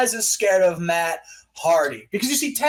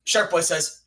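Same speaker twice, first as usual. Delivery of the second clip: ordinary delivery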